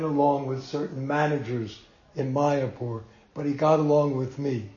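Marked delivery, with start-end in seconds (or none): none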